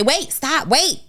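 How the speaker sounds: high, sudden words of caution; urgent mentor. Acted. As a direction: cut in to halt a rash move. Mentor voiced stopping someone in time.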